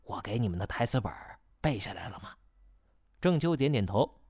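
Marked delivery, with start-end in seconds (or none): 0.0-2.3 urgent hushed whisper. Acted. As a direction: whisper it so no one hears. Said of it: "刻意压低声音".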